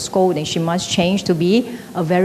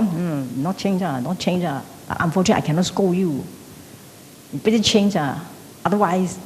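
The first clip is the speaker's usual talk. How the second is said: a quiet, sulky mock complaint; muttered grudge; 2 semitones lower, 7 dB quieter than usual. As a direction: mutter it like a silent curse. Silently cursing, muttered grudge.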